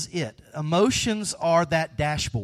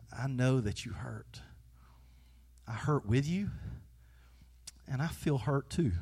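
much softer, gentle and sincere; tender comfort; 3 semitones lower, 10 dB quieter than usual. Pretend. Jesus speaking to a grieving friend, soft and tender. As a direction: hold her grief softly, close. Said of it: "what we see Jesus doing here is says".

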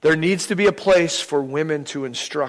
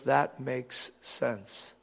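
much softer, very slow realisation; slow quiet acceptance; 3 semitones lower, 13 dB quieter, much slower than usual. Retold or imitated. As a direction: let it sink in slowly. Woman quietly accepts explanation.

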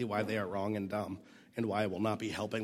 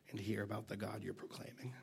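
whispered; 6 dB quieter than usual. Real, not acted. Sermon point said hushed.

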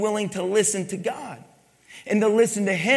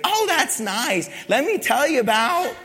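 high, louder mock brush-off; self-absorbed acquaintance; 8 semitones higher, 4 dB louder than usual. Self-absorbed person brushing off news of a death, high mock interest. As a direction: imitate someone eagerly steering the talk to themselves. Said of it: "it was always about them".

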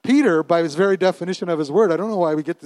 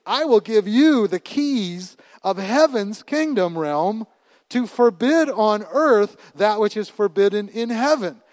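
slow, higher, fervent reading; scripture preaching; 6 semitones higher, 4 dB louder, slower than usual. Acted. Preacher reading Jesus' promise to the church, fervent and slow. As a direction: read it out like a decree.

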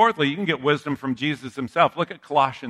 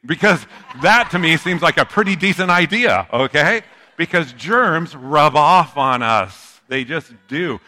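loud, raised-pitch insistence; emphatic self-justification; 4 semitones higher, 8 dB louder than usual. Real, not acted. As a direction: argue the point with comic force. Comic defence of germaphobe dream.

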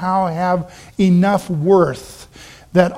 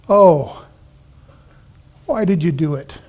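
understated groan of dismay; mild groan. Acted. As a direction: groan it lightly, as the dismayed sailors. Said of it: "they groaned".

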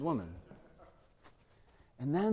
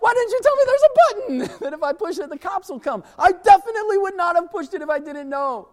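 much higher, louder defensive whine; whiny protest; 13 semitones higher, 7 dB louder than usual. Retold or imitated. Teen arguing back at the boss, high whiny protest. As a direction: protest your innocence like a kid.

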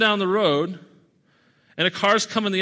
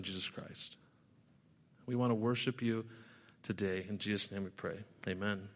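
earnest prayer that fades softer as it goes; 4 semitones lower, 5 dB quieter than usual. Acted. Pastor praying before the sermon, growing hushed and earnest. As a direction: pray it to the Father, sinking lower and quieter toward the end.

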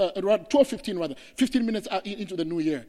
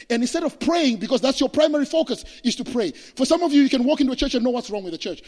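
fiery preaching; 7 semitones higher, 9 dB louder than usual. Acted. Preacher builds to full force.

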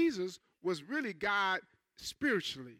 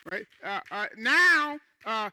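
building cry; 3 semitones higher, 8 dB louder, slower than usual. Real, not acted. Preacher building up.

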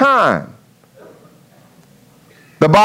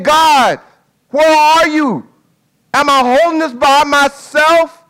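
raised, pleading call upward; anguished cry; 6 semitones higher than usual. Acted. Jesus calling to the Father, raised anguished plea. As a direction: cry out to God, feeling abandoned.

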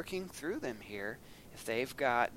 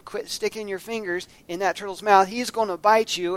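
higher, louder scolding mother voice; scolding mom, quicker and raised; mama's warning; 5 semitones higher, 7 dB louder than usual. Retold or imitated. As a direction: warn the boy like his mama. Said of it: "My mama used to tell me".

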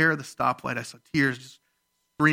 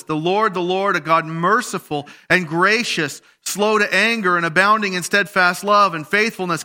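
rising, louder scripture reading in full cry; preaching scripture; 5 semitones higher, 4 dB louder than usual. Acted. Pastor reading Exodus to the congregation, raised and proclaiming. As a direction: proclaim the verse like a call from the pulpit.

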